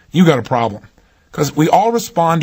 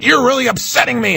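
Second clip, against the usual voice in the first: mock scream; 7 semitones higher than usual. An upset person confronting you, mock high-pitched scream. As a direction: imitate someone lashing out, pitch jumping up. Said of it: "they scream at you".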